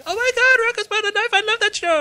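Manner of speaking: high, loud excited squeal; mock excited scream, far above your own voice; girls screaming. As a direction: imitate shrieking fangirls at full pitch. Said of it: "two of the girls just start screaming".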